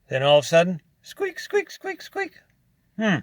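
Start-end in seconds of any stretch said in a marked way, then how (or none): 1.0-2.3 high squeaky mimicked squeak; squeak imitation. Imitating his truck's squeak for viewers.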